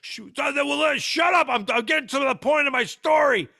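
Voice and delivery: flustered high shouting, hammed up; loud, exasperated mock tirade; mock yelling. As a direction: imitate someone hollering over interruptions. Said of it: "He starts yelling at him".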